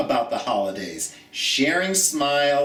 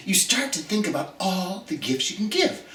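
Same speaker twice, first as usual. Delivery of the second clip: ordinary delivery, nothing marked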